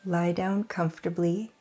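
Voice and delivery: usual speaking voice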